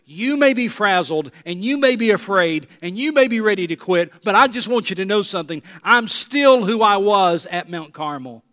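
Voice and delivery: impassioned preaching. Acted. Preacher voices God reassuring Elijah, impassioned preaching. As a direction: preach it out forcefully, high and loud.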